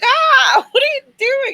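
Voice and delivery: high, loud mock yelp of protest; high-pitched startled protest, half laughing; retold pained yelp. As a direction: act out being poked on a sore spot. Retold pain when bump is poked.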